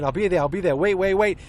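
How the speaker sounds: retold panicked call from a speeding car; frantic drummer. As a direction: beg them to hold on while racing. Late drummer on phone, speeding.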